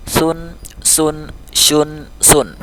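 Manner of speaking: "Sun, sun, shun, sun": lisped, hissed, blown, then rounded; held "Sun, sun, shun, sun", each s distinct; contrasting sibilant letters. Shown as a demonstration. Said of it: "huruf sod dia agak bulat".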